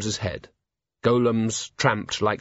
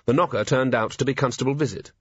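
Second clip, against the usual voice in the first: plain reading voice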